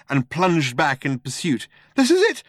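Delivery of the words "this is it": very high, loud panic; shrieky alarm; panicking. A narrator's reading. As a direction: cry it out as death approaches. Man facing certain death.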